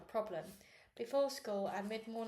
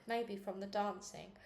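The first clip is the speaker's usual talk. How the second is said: ordinary delivery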